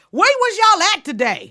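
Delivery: loud fan voice. Retold or imitated. Fans quoted.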